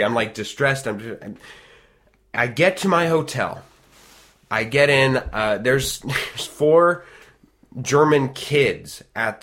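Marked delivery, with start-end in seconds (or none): none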